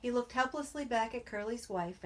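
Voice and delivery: usual speaking voice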